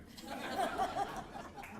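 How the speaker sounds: a sudden high, airy giggle at himself; high breathy giggle. Real, not acted. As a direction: laugh off the joke in a high, breathy burst. Laughs at own joke.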